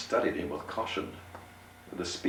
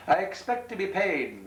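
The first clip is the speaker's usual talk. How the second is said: higher, pointed innkeeper voice; 13 semitones higher, 3 dB louder than usual. Acted. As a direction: say it pointedly, pitched up. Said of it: "said pointedly".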